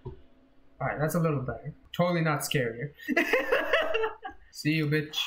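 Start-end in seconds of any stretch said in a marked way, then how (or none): 3.0-4.3 high breathy nervous laugh. Real, not acted. Player in scary game, nervous laugh. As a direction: laugh off the fear.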